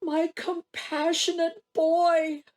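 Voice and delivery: high, loud, grief-stricken tribute; slow down as the emotion swells; anguished pride. Acted. Mother mourns and admires her son.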